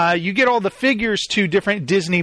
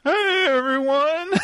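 mock voice crack; 8 semitones higher than usual. Host mocking his own greeting to listeners, cracked voice. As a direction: exaggerate the cracking voice for a joke. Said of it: "my voice kinda cracked there".

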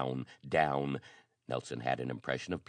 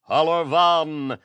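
war cry; 11 semitones higher, 10 dB louder, slower than usual. Acted. Battle cry in a melee.

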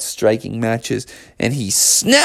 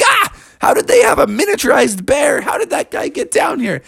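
startled moles. Acted. Moles shocked by small bear.

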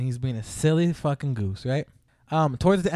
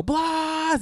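mock scream; 13 semitones higher than usual. Someone calling across the room, imitated high scream. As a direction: imitate a distant fan screaming a name. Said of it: "start screaming".

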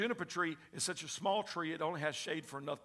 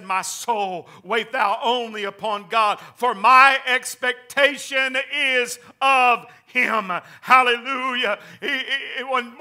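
full-force preaching; 7 semitones higher, 17 dB louder than usual. Acted. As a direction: build to a ringing proclamation from the pulpit.

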